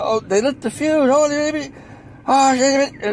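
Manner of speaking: high mock panic; lightly hammed-up high-pitched alarm; imitated panicked Irishman. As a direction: imitate a flustered worker spotting danger. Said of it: "they sound funny".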